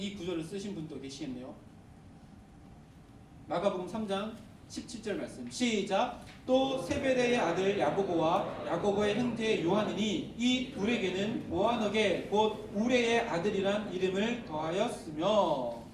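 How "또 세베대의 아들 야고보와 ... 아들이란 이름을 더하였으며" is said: slow, measured reading aloud; scripture reading. Acted. Pastor leads the church reading the verse aloud, slow and measured. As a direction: read the verse slowly and evenly.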